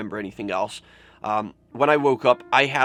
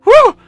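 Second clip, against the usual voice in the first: relieved whew; 18 dB louder than usual. Real, not acted. Relief after a depressing story.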